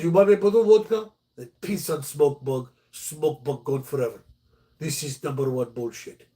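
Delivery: mock Russian accent in broken English; Vlad's Russian accent. Vlad offering to kill the fire ants, mock Russian accent. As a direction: imitate the Russian neighbor offering a deal. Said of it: "we had a Russian guy next door".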